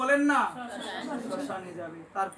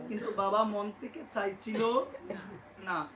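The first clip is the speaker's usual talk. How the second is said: ordinary talk, nothing marked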